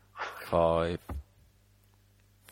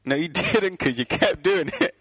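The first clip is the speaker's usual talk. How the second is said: laughing; 9 semitones higher than usual. Real, not acted. Host laughing rebuttal.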